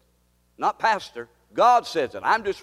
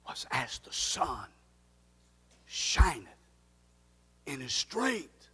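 hushed whisper; 5 dB quieter, slower than usual. Acted. Sudden drop after loud build.

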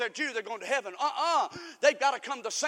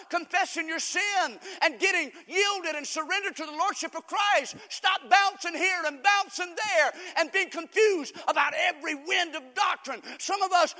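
high, driving, full-force preaching; relentless raised pulpit cadence; urgent exhortation; 6 semitones higher, 5 dB louder than usual. Real, not acted. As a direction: press the congregation hard to change.

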